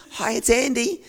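chirpy phone hello; hopeful greeting. Retold or imitated. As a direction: greet the caller with eager hope. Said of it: "hoping it's gonna be good news".

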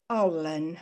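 a gentle, tender "Oh, Lynn"; kind gentle rebuke. Retold or imitated. God gently rebuking Lynn, soft tender sigh. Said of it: "It was said with love and kindness".

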